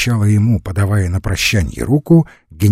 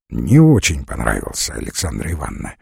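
low-pitched, brief answer; curt reply; 10 semitones lower than usual. A narrator's reading. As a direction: answer shortly, dropping the pitch well down. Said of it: "коротко отвечал Подозёров". (коротко, низким голосом)

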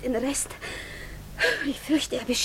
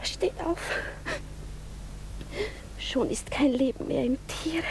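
much softer, ending in a whisper; hushed, tender plea; soft urging; 9 dB quieter than usual. Acted. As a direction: gently whisper him up off his knees. Princess urges the kneeling youth.

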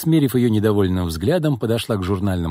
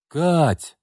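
high, falling call; acted raised-voice summons; calling loudly; 7 semitones higher than usual. A narrator's reading. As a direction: call your sister through a closed door. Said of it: "громко позвала она".

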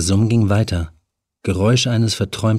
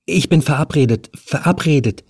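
lightly raised nervous woman's voice; a young woman caught trespassing, higher; Helen, nervous; 5 semitones higher than usual. A narrator's reading. Helen caught in the flat, talking to the stranger, higher and nervous. Helen insists she must go.

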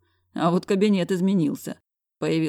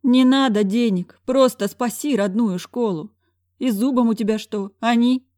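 put-on higher desperate old man; begging director; 7 semitones higher, 6 dB louder than usual. A narrator's reading. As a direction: plead for the school. Director begs to save school.

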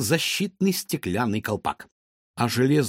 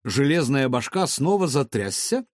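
ordinary delivery, nothing marked

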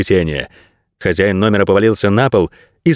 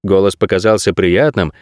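ordinary delivery, nothing marked

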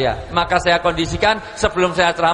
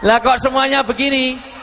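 mock-amazed at the audience; laughing disbelief; 5 semitones higher than usual. Real, not acted. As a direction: tease the crowd for copying.

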